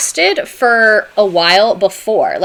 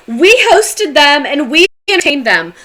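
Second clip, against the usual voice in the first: loud, high-pitched self-congratulation; mock boasting; 6 semitones higher, 5 dB louder than usual. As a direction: take all the credit loudly, in mockery. Mocking colonists taking credit.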